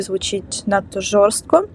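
usual speaking voice

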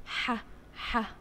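isolated throaty ح, said twice; letter sound h. Shown as a demonstration. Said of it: "صوت حرف الحاء". (نطق صوت ح منفصل)

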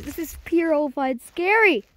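ordinary delivery

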